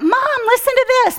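high, eager call to mom; excited call. Retold or imitated. Waking her mom to show her tongues, high and eager.

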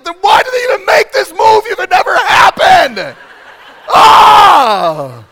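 high, full-volume outraged shouting; hammed-up exasperated rant; mock angry outburst. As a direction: yell the question, then let out a scream. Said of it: "anger comes".

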